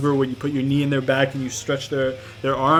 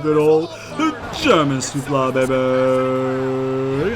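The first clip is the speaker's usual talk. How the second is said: hype call; slower than usual. Acted. Announcing his finisher move.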